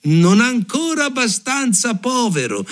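taunting sparrow. A narrator's reading. Sparrow answering the ruined carter, high-pitched taunt. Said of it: "ribatté il passero".

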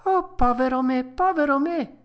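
put-on high, loud puppet-boy wail; despairing cry. A narrator's reading. Pinocchio lamenting his donkey fate, high loud cry. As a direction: cry out in self-pity. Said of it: "gridò Pinocchio".

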